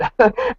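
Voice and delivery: sudden squeaky laugh; giggling at own story; high burst of laughter. Real, not acted. Laughing at getting away with it.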